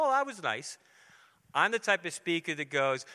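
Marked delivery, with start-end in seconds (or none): 0.0-0.8 higher, dismissive little sigh of approval; lightly mocking a content listener. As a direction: brush it off like a bored churchgoer. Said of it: "and go".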